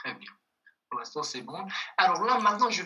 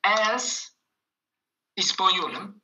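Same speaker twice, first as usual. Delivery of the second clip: words split by a pause, louder and higher; slow dictation; 3 semitones higher, 6 dB louder, slower than usual. Shown as a demonstration. Teacher reading the next sentence aloud for students to write, word by word.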